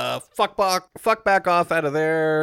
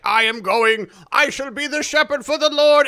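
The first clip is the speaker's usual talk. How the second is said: high, loud, grand pronouncement; preacher announcing his ascension, high and grandiose; solemn preacher; 10 semitones higher, 6 dB louder than usual. Retold or imitated. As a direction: declare it like a holy vow. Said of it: "he's like".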